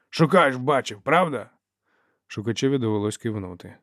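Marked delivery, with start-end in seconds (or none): 0.1-1.5 higher, louder demand for backing; barked challenge. A narrator's reading. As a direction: press the witness for a yes. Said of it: "гаркнув він". (напористо, підвищеним голосом)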